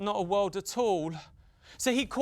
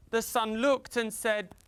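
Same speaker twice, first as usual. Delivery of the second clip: usual speaking voice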